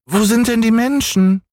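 hohe, quengelnde Kinderstimme; kleiner Junge schreit. Acted. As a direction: fordernd wie ein ungeduldiges Kind. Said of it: "rief der kleine Häwelmann hinauf". (hoch und fordernd gerufen)

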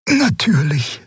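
high, whispered character voice; Xea's whispery voice. A narrator's reading. Xea agreeing to leave the two alone, high whisper. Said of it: "sagte Xea".

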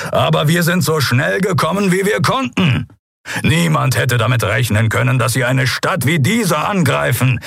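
high, projected appeal. A narrator's reading.

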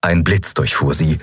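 ordinary delivery, nothing marked

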